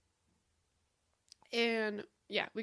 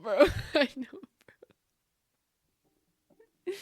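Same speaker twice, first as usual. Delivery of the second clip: ordinary delivery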